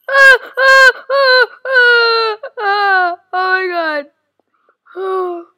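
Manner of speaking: high crying screams of fright; terrified screams. Real, not acted. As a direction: scream in real terror.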